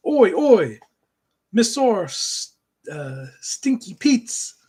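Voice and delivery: a hint of Ren's accent, with a loud "Oi, oi"; lightly put-on Ren accent, slowing at the end. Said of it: "Ren's accent here".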